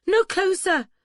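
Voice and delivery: high, loud panicked warning; shrill panic. A narrator's reading. Bather warning Duncan off as he steps nearer, shrill panic. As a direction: cry out to keep them back, shrill and urgent. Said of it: "the shrill panic in her voice".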